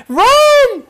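very high, loud panicked yell; mimicked high-pitched scream of alarm; imitated octave-jumping scream. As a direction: shriek it octaves above your own voice. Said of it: "you can hear the octaves change crazy".

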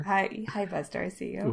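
put-on quiet baby talk to a dog; soft cooing. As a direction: coo softly at the puppy.

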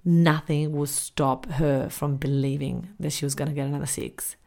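ordinary talk, nothing marked

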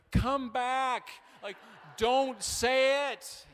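high, loud, stretched-out cry of panic; panicked plea. Retold or imitated. Pastor pleading with his own runaway words, high and loud. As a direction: beg the words to come back.

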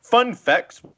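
high, loud announcer intro. Pretend. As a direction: announce it like a show title. Announcing the segment title.

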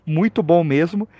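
ordinary talk, nothing marked

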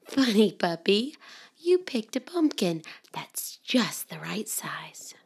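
soft hushed storybook whisper; whispered story reading. Acted. Mother reading to children in a soft whisper. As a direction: hush it like a bedtime book.

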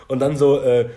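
ordinary talk

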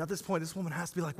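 normal talking voice

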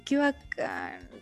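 fake enthusiasm. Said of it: "Y yo le dije".

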